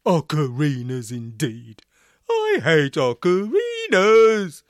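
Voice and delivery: loud, slow brassy fury; angry trombone voice. A narrator's reading. Barnstable turns on the ocarina-clad prisoners, furious. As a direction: spit the hatred like a trombone blast.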